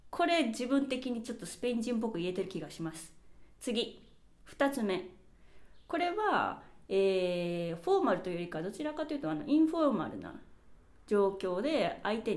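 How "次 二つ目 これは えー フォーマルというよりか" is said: ordinary talk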